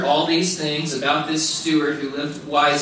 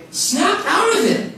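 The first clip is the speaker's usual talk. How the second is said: even higher, slower, laughing "Snap out of it"; second "Snap out of it", slower with a laugh; laughing repeat; 12 semitones higher, slower than usual. Retold or imitated. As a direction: push it over the top with a laugh.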